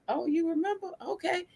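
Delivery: high, soft mock surprise; a light amused oh, okay; a touch of amused surprise. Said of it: "I'm like".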